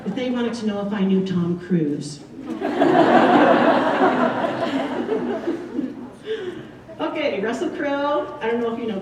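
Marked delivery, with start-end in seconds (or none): 2.2-6.9 long breathy laugh, higher and louder; amused breathy laughter. Real, not acted. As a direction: let a big laugh roll out. Laughs after Tom Cruise story.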